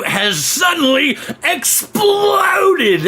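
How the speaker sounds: high, drawn-out announcer swell; mock-dramatic hype. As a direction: stretch it out into a big high cry. Hyping Justin's sudden rise.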